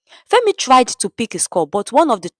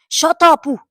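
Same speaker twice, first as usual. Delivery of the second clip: higher, louder snap; angry shout; 8 semitones higher, 3 dB louder than usual. A narrator's reading. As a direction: silence him. Thug silencing Femi.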